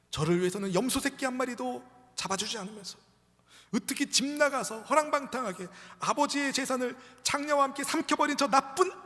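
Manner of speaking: high, loud, aggrieved outburst; acted indignation at full pitch; raging elder son. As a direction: rail against the favoritism. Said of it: "막 분노하는 거예요".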